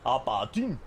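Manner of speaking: bad Aberdeen accent, rising at the end; poor Aberdeen accent. Shown as a demonstration. Streamer repeating his poor Aberdeen accent for chat. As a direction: put on Aberdeen Scottish with an upturned ending. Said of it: "Poor Aberdeen accent".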